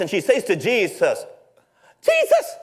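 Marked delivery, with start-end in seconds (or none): 2.0-2.6 a sister's sudden indignant outburst; snapping complaint. Acted. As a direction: lash out, fed up and overworked. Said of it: "she suddenly snaps".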